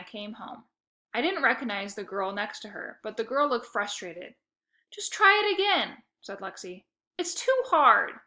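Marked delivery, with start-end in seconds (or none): 4.9-6.0 high, loud impatient push; impatient tutor; annoyed. A narrator's reading. Lexi presses Shelby to retry, loud and annoyed. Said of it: "insisted Lexi, clearly annoyed". 7.2-8.2 slow, high, loud whine; whiny girl. A narrator's reading. Shelby whines to Lexi about the worksheet, slow whine. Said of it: "Shelby whined".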